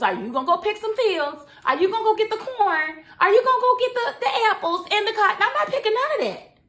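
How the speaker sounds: high, loud rhetorical questions; indignant challenge. Real, not acted. Challenging viewers about who would pick the crops, indignant.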